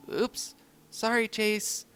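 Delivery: high, slow pup voice saying sorry; a clumsy pup saying sorry; sheepish apology. Acted. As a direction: apologise after tumbling on him. Marshall apologising after tripping.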